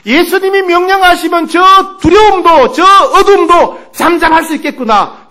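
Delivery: high, building to loud proclamation; surging conviction. Acted. Fearful sister reads the passage, with surging conviction. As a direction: let the realization grow into triumph. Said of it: "그런 확신이 들더라는".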